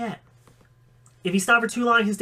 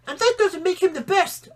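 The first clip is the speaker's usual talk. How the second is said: put-on much higher child voice pointing out; know-it-all kid; 12 semitones higher than usual. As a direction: correct him like a smug little kid. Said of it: "Ice Smile pointed out".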